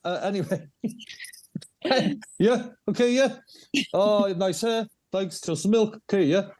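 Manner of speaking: high, playful north Welsh lilt, half laughing; Pwllheli local. Acted. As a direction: keep riffing as a Pwllheli local. Keeps playing Pwllheli local, laughing.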